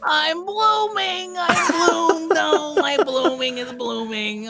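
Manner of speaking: high, stretched squeal; giddy squeal, stretching every word; squeaky high voice. Pretend. Repeating the blooming bit.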